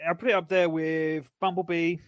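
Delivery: normal talking voice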